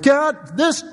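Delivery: retold anguished outcry to God, pitched high; crying out. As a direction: cry out to God in halting, high-pitched bursts. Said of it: "I remember crying out to the Lord".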